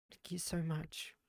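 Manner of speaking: choked-up whisper of thanks; barely voiced, holding back tears; tearful whisper. Real, not acted. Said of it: "Sorry, I didn't mean to get teary".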